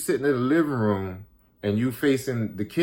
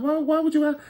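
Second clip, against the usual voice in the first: much higher, incredulous stammered question; baffled; 14 semitones higher, faster than usual. Retold or imitated. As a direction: sound thrown by the question. Confused reply to friend's question.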